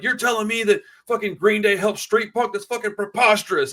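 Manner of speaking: hammed-up teen outrage; mocking the kid. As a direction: lampoon an indignant teen. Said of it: "He's like".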